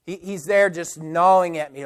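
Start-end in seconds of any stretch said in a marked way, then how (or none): none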